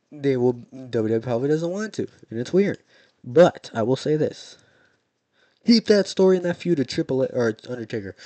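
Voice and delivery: loud, insistent ranting at the company. Real, not acted. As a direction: really lay into them, loud. Ranting demand at WWE.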